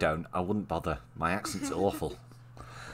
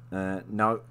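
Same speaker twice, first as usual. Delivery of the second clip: usual speaking voice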